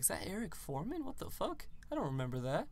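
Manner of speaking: sudden high disbelieving squeak; recognizing a face, baffled and high; surprised. Retold or imitated. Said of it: "It's like".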